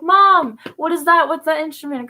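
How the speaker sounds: high, loud retold kid's question; imitating an excited, nosy child; curious kid calling mom. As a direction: call out to mom, then ask eagerly.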